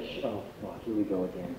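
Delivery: very low, quiet resigned groan; hushed dread. Retold or imitated. Kid in dad's car, hushed resigned dread.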